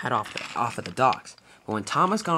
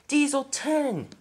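high, drawn-out cry of dread; horrified cry; 12 semitones higher, much slower than usual. A narrator's reading. Thomas spotting his enemy on the hill, horrified cry. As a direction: stretch the name out in alarm, pitched way up.

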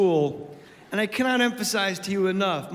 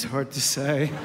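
much lower, beaten-down mumble; defeated kid mumble; 7 semitones lower, slower than usual. Retold or imitated. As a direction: say it like the day crushed you. Son's defeated reply again.

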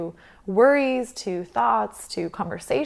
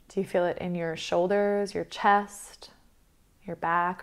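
guided meditation; 6 dB quieter than usual. Acted. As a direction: guide them calmly through the breath, leaving space between phrases.

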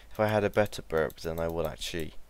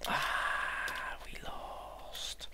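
whispered; 9 dB quieter, much slower than usual. Real, not acted. Defeated mutter.

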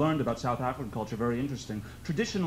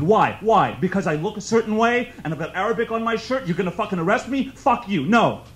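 furious shouting, higher and much louder; livid; 6 semitones higher, 7 dB louder than usual. Retold or imitated. Being arrested, he shouts back at the conductor in fury. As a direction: hurl it back in outrage. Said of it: "I'm livid".

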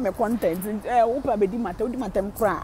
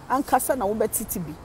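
ordinary talk, nothing marked

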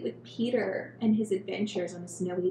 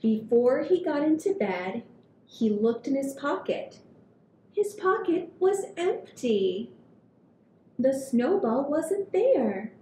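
put-on gentle read-aloud for kids; storybook reading; 3 semitones higher, 4 dB louder than usual. A narrator's reading. Reading picture book to children.

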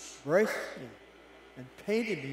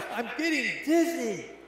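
high, loud and slow, reeling; dizzy shout; 10 semitones higher, 8 dB louder, slower than usual. Acted. Teacup shouting to its master from the wheel. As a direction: cry it out while spinning out of control. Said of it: "I yelled".

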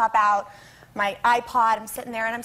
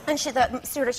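normal talking voice

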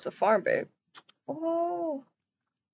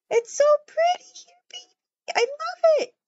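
much higher and louder, gushing; excited squeal; 12 semitones higher, 4 dB louder than usual. Pretend. Girlfriend touring her new home, high gushing squeal. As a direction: squeal over the pretty place.

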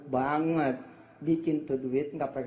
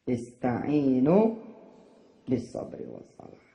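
Quranic recitation; 3 semitones lower, 3 dB louder, slower than usual. Acted.

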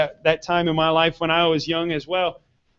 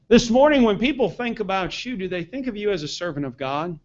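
usual speaking voice

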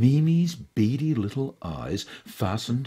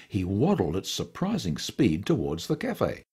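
ordinary delivery, nothing marked

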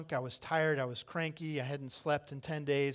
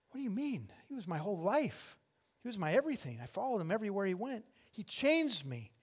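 higher-pitched, pleading protest; pained protest; 5 semitones higher than usual. Acted. Grieving follower tells strangers who he was to her, pained protest. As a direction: explain your loss in exasperated disbelief. Acts Mary's protest in grief.

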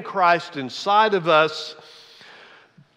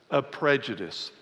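normal talking voice

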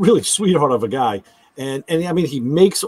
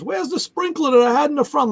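quoting wife; 11 semitones higher than usual. Retold or imitated.

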